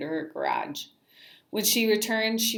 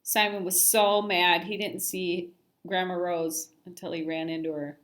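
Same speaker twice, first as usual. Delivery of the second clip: ordinary delivery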